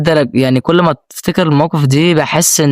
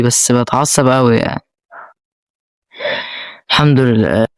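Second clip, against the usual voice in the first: ordinary talk, nothing marked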